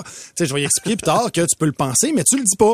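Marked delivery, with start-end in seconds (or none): none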